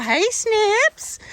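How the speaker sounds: high sing-song hello to a horse; high fond greeting; pet greeting. Real, not acted. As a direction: call him sweetly, pitched high. Greeting her horse Snippy.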